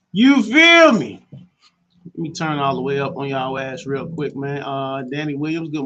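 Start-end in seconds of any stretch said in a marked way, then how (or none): 0.1-1.2 slow, loud, high radio hype; hype call-out. Acted. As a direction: stretch it out to pump up listeners.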